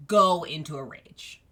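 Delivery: ordinary talk, nothing marked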